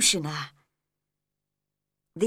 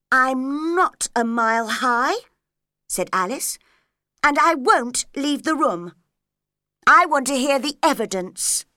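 louder, firm protest; defiant Alice; 5 semitones higher, 6 dB louder than usual. Acted. Alice defying the Queen, louder and firm. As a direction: stand your ground.

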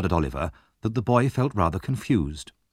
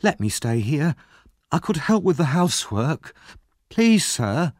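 put-on higher-pitched boy begging to stay; pleading exclamation; 6 semitones higher than usual. A narrator's reading. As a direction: plead like an anxious child. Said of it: "he exclaimed".

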